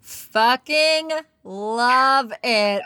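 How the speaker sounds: drawn out slowly, relishing each word; delighted. Real, not acted. Host relishing the guest's cry-it-out advice, slow and delighted. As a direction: savor it like the best line you've heard.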